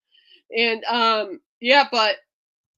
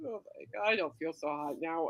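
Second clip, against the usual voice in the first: faint queasy murmur; 7 semitones lower, 12 dB quieter than usual. Retold or imitated. Retelling feeling faint.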